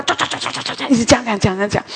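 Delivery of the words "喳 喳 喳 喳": whispered mock woodpecker pecking sounds; breathy imitation of a beak going non-stop; woodpecker chatter. Preacher mimics the nagging husband as a woodpecker, whispered chatter. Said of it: "像啄木鸟一样".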